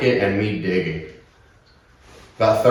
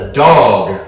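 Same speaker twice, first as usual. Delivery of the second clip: loud, blunt two-word reply; retold blunt answer; 8 dB louder than usual. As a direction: re-enact a curt, forceful answer. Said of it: "I said it just like that".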